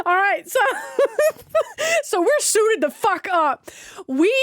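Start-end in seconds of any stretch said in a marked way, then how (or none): 1.5-2.0 high-pitched burst of laughter; real, squeaky little laugh. Real, not acted. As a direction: crack up for a moment. Laughing at own story.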